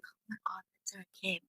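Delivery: hushed gossiping whisper. Retold or imitated. Store customers whispering on spotting Sarah Kim, whispered. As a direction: whisper it under your breath in awe. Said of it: "people start whispering".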